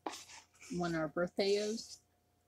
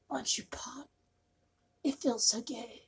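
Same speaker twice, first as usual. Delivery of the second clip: high playful whisper to the can; 5 semitones higher than usual. Pretend. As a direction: coax it open in a high whisper. Whispered coaxing to the Pringles can.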